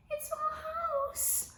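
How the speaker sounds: high, slow squeaky mouse voice. A narrator's reading. Storyteller voicing the sad mouse, high and squeaky. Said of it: "squeaked the mouse".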